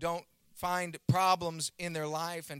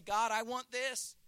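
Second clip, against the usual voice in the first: high, drawn-out wishful plea; slow, lightly hammed-up request; mock pleading; 7 semitones higher, slower than usual. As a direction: imitate someone begging God for a preference. Voicing a believer's demand to God.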